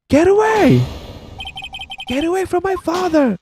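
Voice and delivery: very high, frantic shriek; desperate scream. Acted. Child defending father from attacker, desperate scream.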